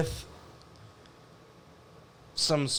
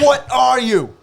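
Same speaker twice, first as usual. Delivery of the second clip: high and louder, scornful chef voice; impression of Gordon Ramsay; 9 semitones higher, 9 dB louder, much slower than usual. Friend redoing the celebrity chef line, louder and scornful. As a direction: bark it at the cowering cook. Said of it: "Gordon Ramsay".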